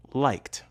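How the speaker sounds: "Liked" ending in a voiceless t. Shown as a demonstration. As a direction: say "Liked" as one syllable with a final t. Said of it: "t in liked".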